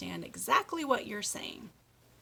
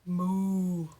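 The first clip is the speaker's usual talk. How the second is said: long, louder playful MOO; cow moo; 5 dB louder than usual. Pretend. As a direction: lightly imitate a cow's moo. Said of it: "what do cows say?".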